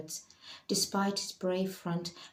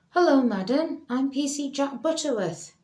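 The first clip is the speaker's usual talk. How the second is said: louder, higher, officious constable voice; a lightly voiced formal police constable; police officer; 7 semitones higher, 8 dB louder than usual. Police officer greets Leela.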